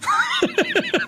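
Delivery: high laugh. Real, not acted. Laughs after falsetto bit.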